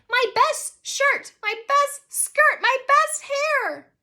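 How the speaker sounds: high, loud, drawn-out wail; slow high-pitched shrieking lament; helpless screaming. A narrator's reading. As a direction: scream each phrase in helpless dismay. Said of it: "Brianna was screaming helplessly".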